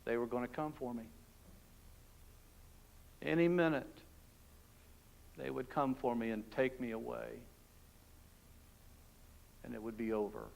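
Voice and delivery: a prisoner's hushed fear of being taken away; hushed dread. Acted. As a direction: barely breathe it out in fear. Terrified prisoner awaiting execution.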